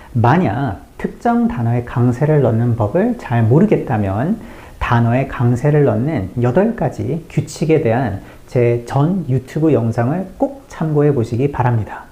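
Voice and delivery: normal talking voice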